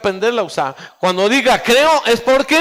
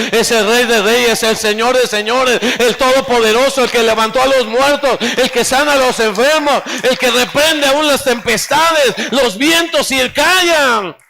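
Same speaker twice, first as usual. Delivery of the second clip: fervent proclamation; 2 semitones higher, 3 dB louder than usual. Real, not acted. Preacher builds fervent declaration.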